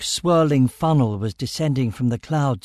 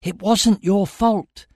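high-pitched, slow, emphatic insistence; urgent; 8 semitones higher, 3 dB louder, slower than usual. A narrator's reading. Narrator absolves shackled Amira, urgent. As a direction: press each word on her urgently. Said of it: "I said urgently".

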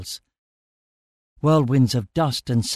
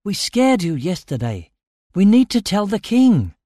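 high-pitched insistence, growing louder; urgent; 8 semitones higher, 5 dB louder than usual. A narrator's reading. Narrator urges Amira to tell the king, urgent. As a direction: build to a pressing appeal. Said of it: "I said urgently".

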